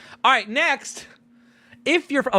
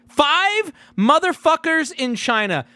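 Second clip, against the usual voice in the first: amazed outburst; 11 semitones higher, 6 dB louder, slower than usual. Real, not acted. Amazed at China viewers.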